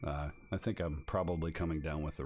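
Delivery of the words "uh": normal talking voice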